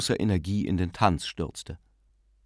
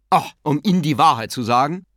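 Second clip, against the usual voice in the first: louder, heated outburst; sudden vehemence; 6 semitones higher, 7 dB louder than usual. A narrator's reading. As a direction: blurt it out hotly. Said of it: "erwiderte Gabriel plötzlich heftig".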